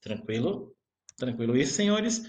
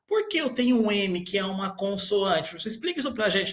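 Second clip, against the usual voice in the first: much higher, insistent student voice; puzzled student; 6 semitones higher than usual. Acted. Teacher acting a student demanding why there is an M, high and insistent. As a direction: fire the questions like a baffled pupil.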